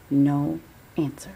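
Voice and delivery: plain reading voice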